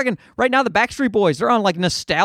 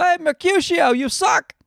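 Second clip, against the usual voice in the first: mock heckler; 9 semitones higher than usual. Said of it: "show up to a Shakespeare play and go".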